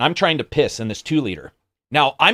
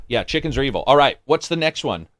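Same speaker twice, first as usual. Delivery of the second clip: usual speaking voice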